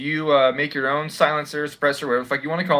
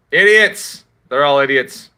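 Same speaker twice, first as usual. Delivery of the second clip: angry shout; 8 dB louder, slower than usual. Real, not acted. Vlogger ranting at YouTube censors, angry shout. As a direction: yell it in real disgust.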